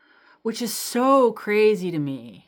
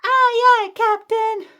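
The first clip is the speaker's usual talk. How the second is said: very high, loud squeaky cartoon chorus; hammed-up cartoon kids yelling their answer; cartoon kids' reply; 9 dB louder, slower than usual. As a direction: shout back like excited cartoon kids. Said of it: "I heard".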